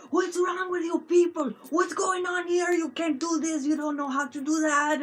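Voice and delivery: high, loud mock-angry rant; hammed-up aggressive scolding; aggressive German friend. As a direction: imitate a German friend who sounds furious but is only kidding. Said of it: "sound aggressive".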